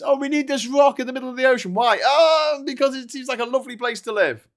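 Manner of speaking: high, loud silly voice; mocking voice. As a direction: ham it up as a clueless patriot. Mocking people fighting over rock.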